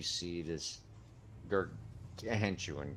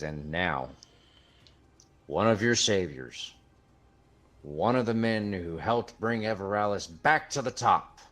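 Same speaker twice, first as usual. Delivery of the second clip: louder, hyped ring announcer build-up; arena announcer; 4 semitones higher, 7 dB louder than usual. Acted. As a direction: announce the hero to a packed arena. Announcer introduces the hero.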